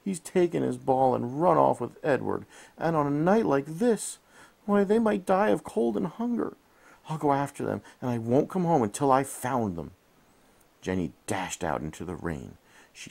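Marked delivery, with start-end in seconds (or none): 0.0-9.9 moaning. A narrator's reading. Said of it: "she moaned".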